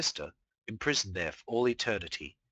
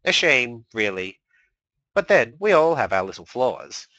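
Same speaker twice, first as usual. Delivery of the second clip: loud, smug and theatrical; smug gloating; 3 semitones higher, 10 dB louder than usual. Acted. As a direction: savour her defeat. Discord gloating over her.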